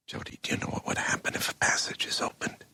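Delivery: low-volume, near-whispered question; hushed warning. Acted. Man warning Jody about an opened passage, hushed warning.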